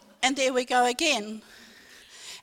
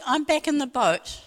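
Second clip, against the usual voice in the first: normal talking voice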